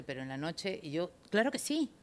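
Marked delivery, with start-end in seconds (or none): 1.3-1.9 pitched up, eager acceptance; eager yes. Retold or imitated. Telling the instructor she's in, eagerly. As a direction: jump at the offer.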